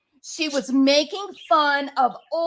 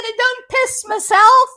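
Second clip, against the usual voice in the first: high and much louder, hammed-up tipsy squeal; drunk mom voice; 13 semitones higher, 10 dB louder than usual. Drunk mom confessing to her son, loud high mock squeal. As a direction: shriek it like the giggling drunk lady. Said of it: "She said".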